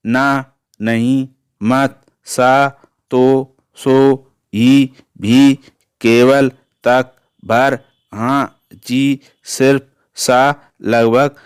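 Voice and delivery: each word spaced out very slowly; slow dictation. Shown as a demonstration. Teacher dictating particles to class, very slow and spaced. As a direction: spell out the list slowly for students to copy.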